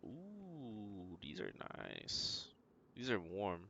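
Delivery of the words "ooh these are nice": admiring whisper. Real, not acted. Admiring a found item.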